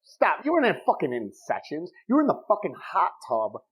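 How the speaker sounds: normal talking voice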